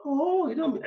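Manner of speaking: high, lightly acted reaction; surprised realisation. Retelling who is in charge in another office, high surprised reaction. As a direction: mimic someone suddenly finding out, pitched well up. Retold exchange about finding a colleague.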